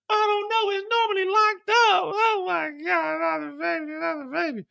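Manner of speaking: squeaky, high mock lament; high falsetto panic. Mireille fretting over another baby, high falsetto lament. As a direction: pitch it way up and fret. Voicing parent and Mireille in story.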